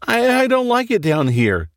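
put-on a frightened little boy whimpering to his mom; lightly voiced scared child; weak scared boy. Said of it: "Danny said weakly".

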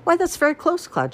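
high, brightened surprise; pleased surprise at a good answer; pleasantly surprised. A narrator's reading. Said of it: "Mother looked surprised and a little pleased".

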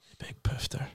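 whispered insult under the breath; muttered insult. Real, not acted. Annoyed at the phone message, muttered insult. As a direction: hiss the insult to yourself.

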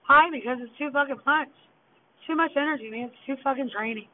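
ordinary talk

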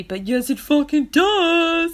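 slow, loud bro voice; frat boy impression. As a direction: drawl it like a cocky frat boy. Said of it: "That was my frat boy, uh, impression there".

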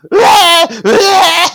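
high, loud mock baby wail; baby crying. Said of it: "[name] really adds ... saying".

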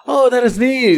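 high-pitched mock wonder; imitated admiration. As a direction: coo like passengers noticing a novelty. Passengers admiring the Uber light.

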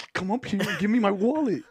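loud, high, urgent holler; mock impatient shout; insistent cousin calling. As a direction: order him upstairs, imitating the cousin. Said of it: "He's like".

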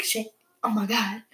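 hushed, whispered swearing to oneself; panicked whisper. Retold or imitated. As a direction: whisper it under your breath. Panicked thought as high hits.

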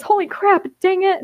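high-pitched dismayed exclamation; mortified. A narrator's reading. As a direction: blurt it out in alarm. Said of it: "Grape looks mortified and says".

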